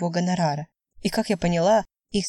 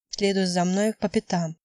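usual speaking voice

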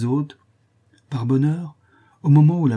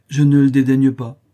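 loud, raised-pitch cool denial; firm, higher, chilly retort; cold reply; 5 semitones higher, 9 dB louder than usual. A narrator's reading. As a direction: answer coolly but forcefully. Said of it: "répondit froidement".

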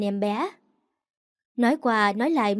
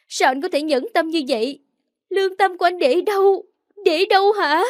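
tearful outrage; 7 semitones higher than usual. A narrator's reading. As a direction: hurl the questions at him in bitter grief. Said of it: "vừa khóc vừa uất ức".

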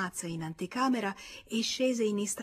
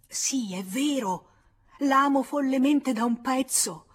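passionate declaration; 6 semitones higher, 8 dB louder than usual. Acted. Georges at her feet declaring his love, passionately loud. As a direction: pour out the love at full voice. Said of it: "ripetendo appassionatamente".

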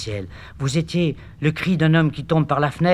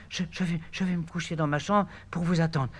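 fading weak voice; 4 semitones higher, 9 dB quieter than usual. Acted. Eurydice weakening.

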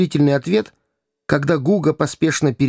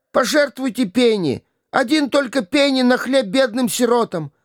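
higher, wailing put-on beggar's plea; milk the stranger's pity; fake fit; 11 semitones higher than usual. A narrator's reading. Hugo, feigning a fit, begs the gentleman for pennies, whining.

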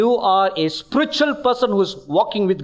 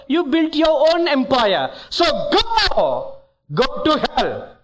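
rising rebuke; 7 semitones higher than usual. Retold or imitated. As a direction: build the verdict to a thundering send-off. Jesus's verdict rising to dismissal.

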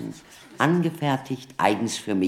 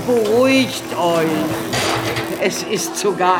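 higher and louder, over the crowd; calming call; 5 semitones higher, 3 dB louder than usual. Acted. The doctor calls out to calm the panicking villagers, raised voice. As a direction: raise your voice to settle a frightened crowd.

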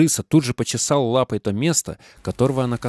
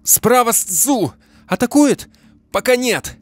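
high, loud race callout; shouting; 12 semitones higher, 5 dB louder, slower than usual. Acted. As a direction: yell the warning over the noise. Calling out rivals mid-race. (громкий крик на скаку)

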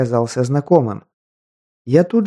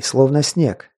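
plain reading voice

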